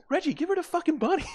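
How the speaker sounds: high sarcastic sibling snap; exasperated sister. Retold or imitated. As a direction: ham up the fed-up sister.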